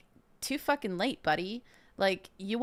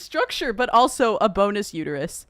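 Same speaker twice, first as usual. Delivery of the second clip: high, louder mocking paraphrase; 5 semitones higher, 6 dB louder than usual. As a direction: parody the guest's big words. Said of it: "He's trying to say".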